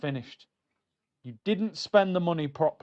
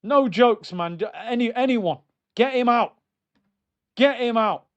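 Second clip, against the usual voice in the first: higher and louder, fed-up rant; 6 semitones higher, 5 dB louder than usual. Real, not acted. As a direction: demand it loudly. Ranting manager out.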